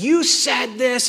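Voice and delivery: demanding accusation. Acted. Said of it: "coming in front of people and being like".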